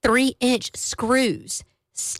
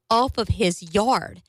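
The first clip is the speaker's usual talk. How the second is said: usual speaking voice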